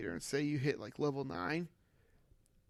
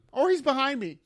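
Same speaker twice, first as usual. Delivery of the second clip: sudden high spooked cry; playfully frightened squeal; mock scared; 9 semitones higher, 6 dB louder than usual. Imagining a stalker behind him.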